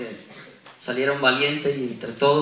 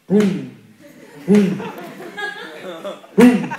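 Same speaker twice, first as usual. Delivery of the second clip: stomping booms, growing louder; giant footsteps booming; 3 semitones higher, 4 dB louder, much slower than usual. Pretend. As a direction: thud out each giant step, building. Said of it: "Goliat otra vez con sus pasos".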